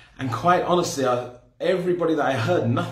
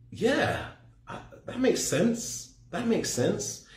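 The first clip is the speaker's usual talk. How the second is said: higher, softer, musing to himself; dawning agreement; 6 semitones higher, 4 dB quieter, much slower than usual. Retold or imitated. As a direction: slowly realise that it adds up. Acts out his dawning agreement while listening.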